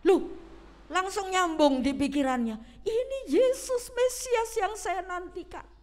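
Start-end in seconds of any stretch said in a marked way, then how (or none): 2.9-5.7 pitched up high with sudden hope; the blind man catching on, thrilled; excited realisation. Acted. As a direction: blind beggar realising the Messiah is passing, excited.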